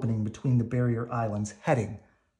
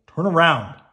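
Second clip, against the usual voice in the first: urgent shout; 5 semitones higher, 8 dB louder than usual. A narrator's reading. Maui shouts in battle.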